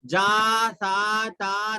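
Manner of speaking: "جا سا تا" with each vowel held long, run together; wrongly stretched vowels. Shown as a demonstration. Teacher showing how not to read, long stretched "جا سا تا". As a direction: drag out every "aa" in "جا سا تا". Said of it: "نہیں پڑھیں گے".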